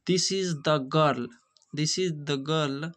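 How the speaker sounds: put-on very slow, each word of "This is the girl" drawn out; slow emphatic reading. Shown as a demonstration. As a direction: spell out "This is the girl" slowly for the class. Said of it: "यहाँ हो जाएगा this". ("This is the girl" धीरे-धीरे ज़ोर देकर)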